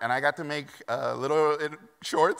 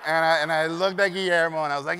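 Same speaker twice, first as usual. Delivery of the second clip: ordinary delivery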